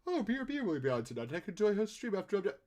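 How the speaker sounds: high, bouncy naive enthusiasm; mock eager self-quote. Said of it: "I'm like".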